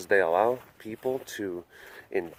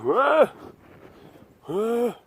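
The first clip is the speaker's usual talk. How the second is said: put-on much higher, startled old-timer voice; dazed grandpa cat woken up; voicing the old cat; 11 semitones higher, slower than usual. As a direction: squeak it out confused and sleepy. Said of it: "He's like".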